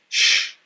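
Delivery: sh said loud and long, hissed; sh sound. Shown as a demonstration. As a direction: hiss out the X as sh. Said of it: "the letter X is pronounced".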